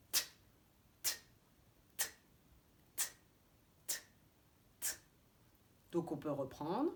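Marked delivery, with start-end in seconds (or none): 0.1-4.9 T as a quick bare consonant, no vowel; crisp T sound. Shown as a demonstration. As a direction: release each T like a snapped elastic, spaced apart. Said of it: "tu le lâches très vite".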